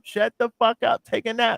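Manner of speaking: high-pitched, exasperated telling-off; comic exasperated outburst; mock scolding. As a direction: scold them with a laugh creeping in. Mock scolding of noisy plane passengers.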